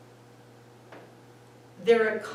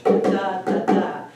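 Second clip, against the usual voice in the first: da, da, da tapped out loud in iambic beats; loud rhythmic da da da; 11 dB louder, slower than usual. Shown as a demonstration. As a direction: beat out the iamb, da da da. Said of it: "do you know what an iamb is".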